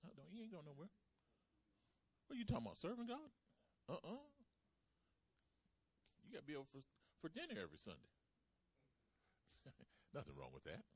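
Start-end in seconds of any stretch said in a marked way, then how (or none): 0.0-8.1 a higher, whiny mock family voice; lightly voiced nagging relatives holding you back; mocking clingy family. Preacher mimics family pulling believers back, mock whiny. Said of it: "They wanna pull you back down like crabs".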